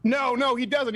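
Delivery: high-pitched, insistent objection; real heated denial; agitated protest. Real, not acted. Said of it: "they just start screaming".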